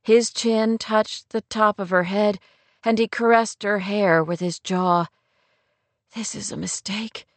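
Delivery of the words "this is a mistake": hushed, intimate murmur; soft murmur. A narrator's reading. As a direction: murmur it very softly to him. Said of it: "Rory murmured".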